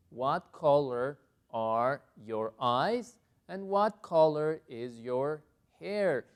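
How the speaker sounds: slow, deliberate English question; slow model question. Shown as a demonstration. Teacher repeating question after a correction, slow and deliberate. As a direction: model it clearly for learners.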